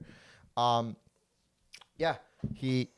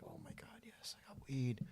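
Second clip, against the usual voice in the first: breathless thrilled whisper; excited whisper; 3 semitones higher, 13 dB quieter than usual. Retold or imitated. Retelling buying pre-rolls at a dispensary, a giddy whisper. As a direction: whisper it like a secret treat.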